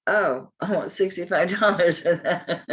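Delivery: laughing at price. Real, not acted. Seller asking too much, she reads it laughing.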